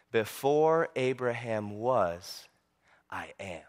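low, slow, solemn declaration; grave and hushed, with great weight; very serious. Acted. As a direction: drop the voice, pause, then soften right down. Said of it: "Jesus gets very serious".

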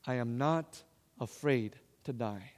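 slow, low and soft; solemn, quiet assurance. Retold or imitated. Grandmother to grandson from her hospital bed, solemn and quiet.

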